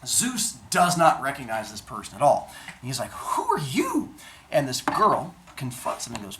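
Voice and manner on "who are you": much higher, slow amazed ask; startled question. Acted. Zeus asks the stranger slowly in high amazement. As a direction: draw it out in wonder. Zeus meets grown Athena.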